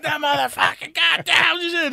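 high, slow imitation of cursing; mock angry yelling. Wife still cursing down the phone, mock angry yelling. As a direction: drag out her swearing in a hammed-up yell. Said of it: "he could hear her yelling".

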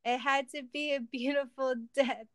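high-pitched mock death speech; villain's theatrical farewell; melodramatic dying words. A narrator's reading. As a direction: deliver it as a hammed-up last line. Villain's dying line in story.

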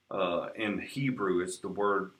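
usual speaking voice

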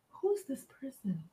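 whispered, unvoiced; whispering who's this person. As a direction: breathe it as a curious whisper. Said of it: "she was like".

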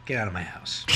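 mock movie line. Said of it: "No mocking".

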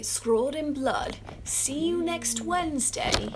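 high, slow eerie film voice; spooky movie quote. Retold or imitated. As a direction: draw it out like a horror trailer. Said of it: "you hear them say".

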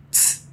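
soft hissed tss; a short soft tss sound, tongue on teeth. Shown as a demonstration. Teacher isolates the German ts sound for students. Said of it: "Tem um sonzinho".

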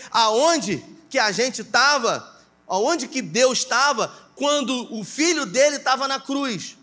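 preaching at full force. Acted. Pastor pressing the congregation, fervent preaching. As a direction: preach it hard, voice lifted.

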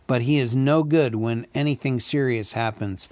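ordinary delivery, nothing marked

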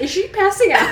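high, loud, laughing alarm; panicked bystander. Pretend. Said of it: "I would be like".

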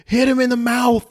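high, loud yell at the screen; screaming at TV. Retold or imitated. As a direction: shout the order at the TV. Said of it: "I scream at the T V".

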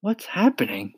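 put-on high-pitched startled boy's question; alarmed cry. A narrator's reading. James leaping out of his hammock, crying out high. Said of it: "cried James".

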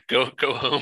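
ordinary talk

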